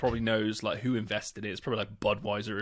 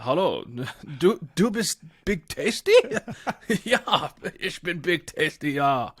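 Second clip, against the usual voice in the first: hammed-up German accent, higher and louder; 5 semitones higher, 6 dB louder than usual. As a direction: play the German guy in a thick German accent, laughing through it. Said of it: "It was in a German accent as well".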